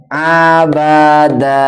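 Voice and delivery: slow, stretched recitation of Abada; drawn-out reading. Shown as a demonstration. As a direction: draw out each vowel of Abada. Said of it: "Kalau ini kan panjang". (bacaan panjang pelan)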